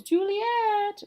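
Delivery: high, loud, drawn-out call; acted holler for someone in another room. Someone summoning Juliet from offstage, loud sing-out call.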